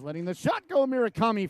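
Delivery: sudden excited shot call. Real, not acted. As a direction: spike the voice as the shot flies. Shot from blue line.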